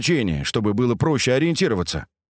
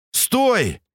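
higher urgent shout; 12 semitones higher than usual. A narrator's reading. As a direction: yell at him to stop. Calling out to stop him.

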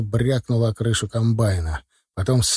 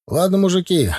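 raised-pitch call, mildly projected; lightly acted shout over the wind; 6 semitones higher than usual. A narrator's reading. Narrator hails the truckers through the wind, mild shout. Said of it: "крикнул я через ветер".

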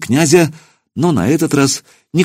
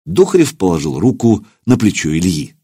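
usual speaking voice

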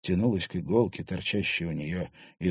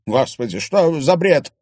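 loud flaring retort; angry outburst; 8 semitones higher, 10 dB louder than usual. A narrator's reading. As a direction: snap back in outrage. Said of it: "вспылил Платон". (вспыльчиво, громко)

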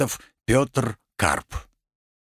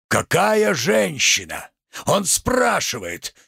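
indignant echo; 3 semitones higher than usual. Acted. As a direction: throw the question back theatrically, drawn out. Tragedian echoes merchant's question indignantly.

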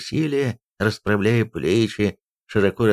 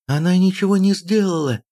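much higher, acted outcry; crying out; 9 semitones higher, 4 dB louder than usual. A narrator's reading. Princess defends her nursemaid, crying out. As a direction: protest in a high, raised voice. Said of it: "вскричала Сульдрун".

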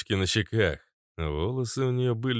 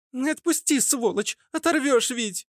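put-on very high girlish protest; pained squealing girl. Girl with ear squeezed protests, squealing high. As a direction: squeal to be let go.

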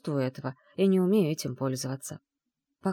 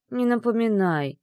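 slightly higher groan of dismay; 5 semitones higher, 4 dB louder than usual. Acted. Bride moaning to her groom, mock despair. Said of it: "простонала я".

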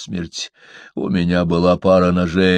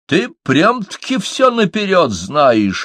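scoffing; 9 semitones higher than usual. Acted. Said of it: "хмыкнул маленький гном".

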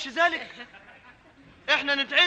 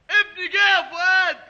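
a new father bursting with fake news; excited shout; 5 semitones higher, 7 dB louder than usual. Husband on phone fooling Fouad, mock-joyful shout. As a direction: shout it high and loud with pretend joy. Fakes news of newborn son.

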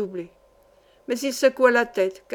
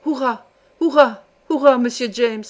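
cheers building to a much louder shout; joyful cheer; 5 dB louder than usual. A narrator's reading. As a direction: burst out with uncontainable joy. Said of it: "ne pouvant contenir sa joie … en s'écriant". (cris de joie qui montent, beaucoup plus fort)

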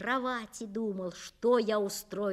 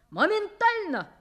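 loud, much higher angry bellow; furious yell; 8 semitones higher, 6 dB louder than usual. Acted. Father yelling at his son to bring the belt, furious. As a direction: roar it across the whole flat. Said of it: "заорал отец на всю квартиру злым голосом".

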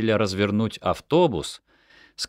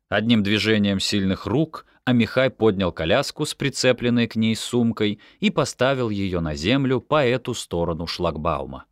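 ordinary delivery

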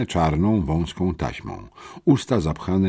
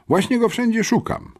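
much higher, annoyed voice; irritated. A narrator's reading. Sister fed up with hunting for her brother, irritated. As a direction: say it worked up, pitched right up. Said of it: "rzekła zdenerwowana".

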